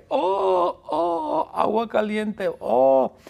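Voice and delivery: high squeaky cries of shock; startled yelp. Acted. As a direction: yelp as the hot water hits. Mr Bean woken by hot water. (gritos agudos de sobresalto)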